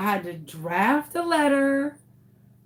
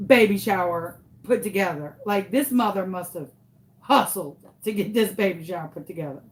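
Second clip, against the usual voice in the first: usual speaking voice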